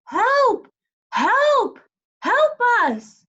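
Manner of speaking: high, loud cry for help; faraway shout. A narrator's reading. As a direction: call out from far away. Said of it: "shouted a faraway voice".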